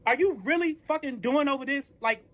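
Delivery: high, incredulous indignant rant; worked-up retold argument; outraged confrontation. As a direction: demand answers, pitch climbing in disbelief. Acted outrage at being charged.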